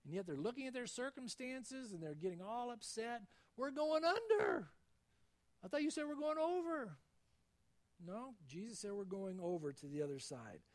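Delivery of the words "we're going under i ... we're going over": high, loud frightened wail, slowed on under; panicked cry. Acted. Disciples in the storm crying out to Jesus, panicked and loud. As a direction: cry out like a sinking sailor.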